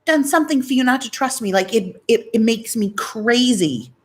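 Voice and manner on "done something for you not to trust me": indignant. Retold or imitated. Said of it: "And I'm like".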